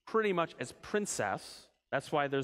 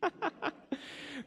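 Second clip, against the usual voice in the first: laugh; 11 semitones higher, 5 dB quieter than usual. Real, not acted. Listener laughs at question.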